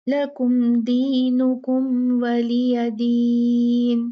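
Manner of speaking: slow chanted tajweed recitation; Quranic recitation. Shown as a demonstration. Teacher recites the surah for her students, slow chant. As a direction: recite Surah al-Kafirun slowly in full. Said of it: "तो हम देखते हैं इसको".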